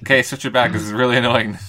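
half-laughing fed-up plea; loud annoyed. Real, not acted. Annoyed request.

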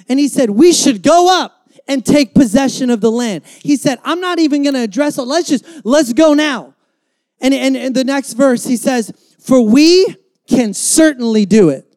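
loud, driving sermon voice; rally the church with loud conviction; full-force preaching. Acted. Preacher urges the church to be a Caleb, loud rallying sermon.

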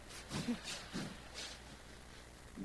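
breathy airy laugh. Real, not acted. Preacher laughs at the thought of riding again, breathy. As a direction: let out a soft laugh.

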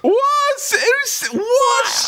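high squeaky double take of disbelief; shocked disbelief. Real, not acted. Cohost reads a question about him, shocked high disbelief. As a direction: blurt it out as if you can't believe what you heard.

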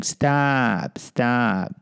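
put-on a timid kid saying stop; meek pleading. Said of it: "aside from saying".

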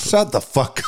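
hammed-up shout at a player; mock shout. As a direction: bark it like a mock scolding. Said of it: "that's what they need to literally tell him".

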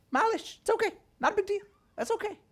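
very high, soft little voice; mock soothing dismissal. Believer giving God the leftovers, softly dismissive. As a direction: excuse it away softly, high and light.

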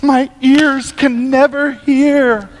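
hammed-up offended piety; mock shocked. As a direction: play the scandalized prude, drawn out. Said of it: "It doesn't shock me".

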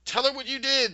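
high, loud accusing yell, imitated. Miranda accusing Tip before the mistress, mock yelling. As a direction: yell it at her as an acted accusation. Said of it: "Miranda yells".

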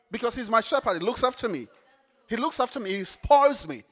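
loud, rousing sermon build; preaching at full force. Acted. Preacher rousing the congregation, loud. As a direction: preach it out big to the room.

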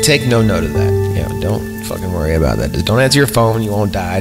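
ordinary talk

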